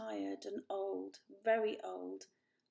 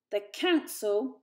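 much louder, slow contemptuous spitting; spat; 9 dB louder, slower than usual. A narrator's reading. Father dismissing the elder Poor, spitting with anger. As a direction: bite off each word. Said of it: "spat Father".

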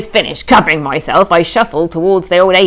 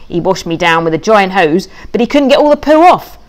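ordinary delivery

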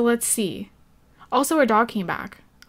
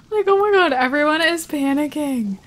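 much higher, louder burst of alarm; excited alarm; 4 semitones higher, 5 dB louder than usual. Real, not acted. Gamer reacting as the house catches fire, high and loud. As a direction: yelp it as the fire spreads.

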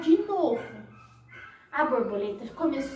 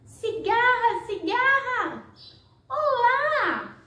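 high, slow call, louder than speech; 9 semitones higher, 3 dB louder, much slower than usual. Acted. Snail calls the cicada from outside, high and loud. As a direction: call her name across to the house.

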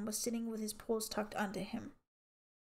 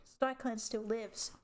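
slow, every word weighted; measured, defiant vow; slow defiant declaration; slower than usual. A narrator's reading. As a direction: declare it, slowly and proudly.

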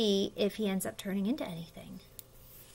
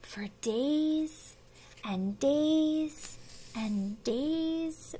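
very slow, higher, stretched-out words; drawn-out; 5 semitones higher, 4 dB quieter, much slower than usual. A narrator's reading. Narrator tells how long pollywog waited, drawn out. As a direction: make the waiting feel endless.

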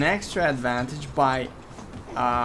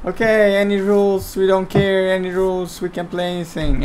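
higher, louder quoted bravado; mock cockiness; 6 semitones higher, 5 dB louder than usual. Caster quoting players who accepted any rules, mock cockiness. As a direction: imitate players boasting they'll take anything. Retelling the players' boastful agreement.

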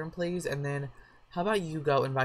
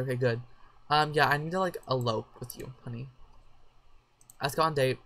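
usual speaking voice

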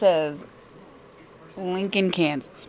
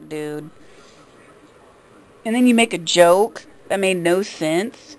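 normal talking voice

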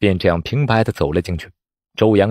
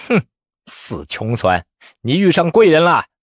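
cold sneer; 5 semitones higher than usual. A narrator's reading. Examiner handing over supplies to the lucky pauper, sneering. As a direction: grudgingly sneer at his luck. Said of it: "冷笑道".

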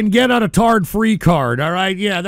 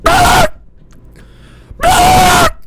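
high raging scream; 9 dB louder than usual. Acted. Staged rage screams.

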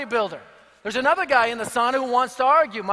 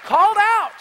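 much higher, louder cry of called out; called out, at the top of the voice; preaching shout; 9 semitones higher, 6 dB louder than usual. Acted. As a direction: ring it out to the back row. Sermon climax, repeated phrase.

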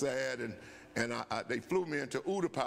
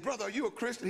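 high, hopeful question; eager question; 7 semitones higher, faster than usual. Retold or imitated. Young airman asking a stranger if he's a believer, high and eager. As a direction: ask it with sudden hope. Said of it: "I said".

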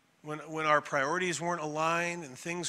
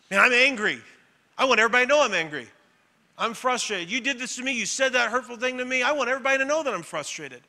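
loud, higher mock-angry outburst; angry venting; 6 semitones higher, 7 dB louder, faster than usual. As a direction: vent it loudly like someone fed up. Voicing an angry venting person.

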